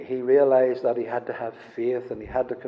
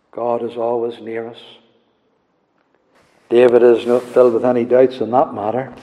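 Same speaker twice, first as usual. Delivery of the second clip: usual speaking voice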